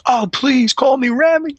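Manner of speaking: high, slow and gracious; polite celebrity. Retold or imitated. Actor asking the cop to call him Rami, high and gracious. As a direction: gently insist on first names. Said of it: "he goes".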